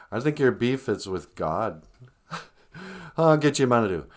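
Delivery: ordinary delivery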